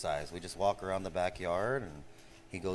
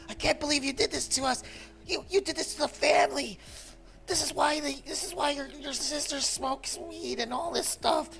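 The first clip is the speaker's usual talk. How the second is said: high mock-mom rant, much higher than his voice; imitating mom yelling. Son retelling his mom scolding him, high mocking rant. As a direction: squeal it out as a hysterical mom. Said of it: "fucking crying, fucking yelling at me".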